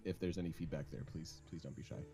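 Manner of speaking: normal talking voice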